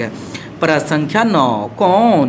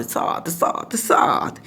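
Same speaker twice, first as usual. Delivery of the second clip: whisper; 7 dB quieter, much slower than usual. Acted. Whispered repeat of the number.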